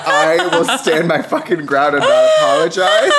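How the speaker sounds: laughing but refusing to back down; laughing defiance. Real, not acted.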